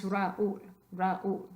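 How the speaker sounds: "Raúl" split slowly into ra-OOL; two-syllable RAÚL. Shown as a demonstration. Teacher shows dos sílabas, "Raúl" split in two. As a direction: separate the a and ú of "Raúl", stress on ú. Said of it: "dos sílabas".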